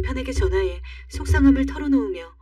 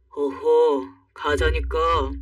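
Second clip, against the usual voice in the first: low, slow fatherly admonishing; insistent father; 4 semitones lower, much slower than usual. Acted. Father overrules his reluctant daughter, low and insistent. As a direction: insist slowly in a low dad voice.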